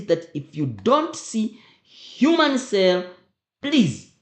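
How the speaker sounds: slow "human cell", then a loud high "please"; emphasized warning. Shown as a demonstration. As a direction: press the warning home to the class. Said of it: "I emphasize this".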